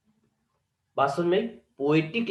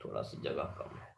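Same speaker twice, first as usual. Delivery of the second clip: very low, quiet mutter; muttered aside; 14 semitones lower, 16 dB quieter than usual. Real, not acted. Teacher to self while short of board space, muttered aside. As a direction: say it under your breath, much lower and softer.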